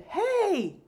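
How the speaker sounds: sharp, high, loud shout. A narrator's reading. Old man stopping the brawl, sudden high shout. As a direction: cut through the fight with one shout. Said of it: "someone shouted".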